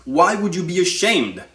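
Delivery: ordinary talk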